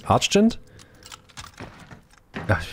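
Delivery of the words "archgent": Archgent as "Atch-ent", tipping up high; struggling pronunciation. Shown as a demonstration. Streamer who cannot pronounce it, questioning high "Atch-ent" for Archgent. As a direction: attempt Archgent with "tch" as an unsure question. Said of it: "Ich kann das nicht aussprechen".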